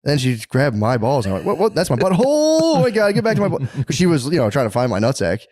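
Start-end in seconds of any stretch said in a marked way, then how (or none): 1.5-3.6 mock shock. Reliving wife's grab, acted shock.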